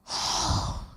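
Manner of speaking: long breathy exhale; heavy sigh. Real, not acted. Speaker pauses in her story, heavy sigh. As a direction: let out a heavy, voiceless sigh.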